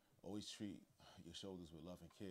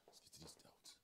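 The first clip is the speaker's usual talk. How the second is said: whispered and softer; 2 semitones lower, 7 dB quieter than usual. Real, not acted. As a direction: breathe it out under the breath. Same line said twice, whispered.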